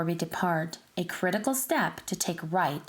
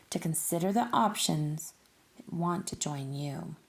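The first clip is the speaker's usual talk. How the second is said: ordinary delivery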